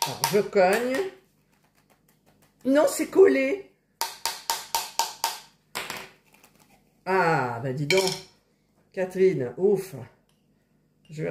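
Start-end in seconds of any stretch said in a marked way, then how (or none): 0.1-10.0 straining to open a jar; effortful bursts while forcing the lid; opening a stuck jar. Real, not acted. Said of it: "J'arrive pas à ouvrir le pot". (en forçant pour ouvrir un pot)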